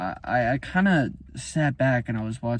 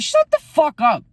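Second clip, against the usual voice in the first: higher, louder furious yell; angry shout; 12 semitones higher, 7 dB louder than usual. Real, not acted. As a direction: cut them off hard. Angry outburst at imagined speaker.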